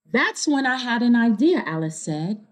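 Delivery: clear, lively storybook reading; reading to children. A narrator's reading. Reader telling kids the story, bright storybook narration. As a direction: read it to a room of kids. Reading picture book aloud.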